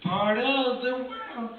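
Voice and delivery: slow, high, whiny singsong; whiny Ariel. Retold or imitated. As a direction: whine it like a sad mermaid. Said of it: "she sounds like a whiny cripple".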